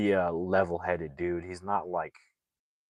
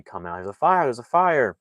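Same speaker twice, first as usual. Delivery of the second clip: raised voice, higher, shouting a warning; calling out a fire alarm; 5 semitones higher, 7 dB louder than usual. Retold or imitated. Spike calling the guards down with a loud fire warning. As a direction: yell to the guards that there's a fire. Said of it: "he's, like, calling everybody".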